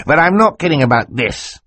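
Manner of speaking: lightly voiced eager uncle; Uncle Monty voice. A narrator's reading.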